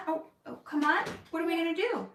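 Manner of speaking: ordinary delivery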